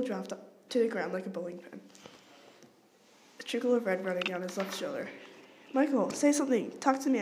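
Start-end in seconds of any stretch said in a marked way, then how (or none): 5.7-7.2 loud desperate cry; howling. A narrator's reading. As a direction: cry out to the wounded friend. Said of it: "I howled".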